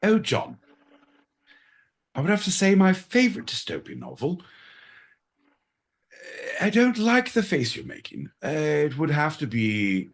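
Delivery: playing a prim Londoner in a put-on English accent; mock posh English accent. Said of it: "we're gonna do accents".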